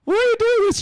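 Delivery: high, loud pleading wail; mock distress. Woman begging the ducks to stop, mock distress. Acting her plea to the ducks.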